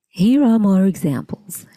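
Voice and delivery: ordinary delivery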